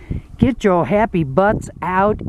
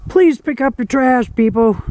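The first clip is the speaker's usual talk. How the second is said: higher, pleading at litterers; exasperated plea; 7 semitones higher than usual. Real, not acted. Hiker pleading with people to pick up trash. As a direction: plead with careless campers.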